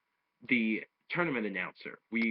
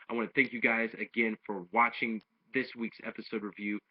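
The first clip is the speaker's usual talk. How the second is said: usual speaking voice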